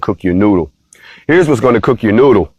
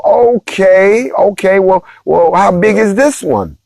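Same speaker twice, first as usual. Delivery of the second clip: higher, incredulous okay then a worried question; mock alarmed; 9 semitones higher, slower than usual. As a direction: act shocked, pitch up the okays. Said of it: "And so we go".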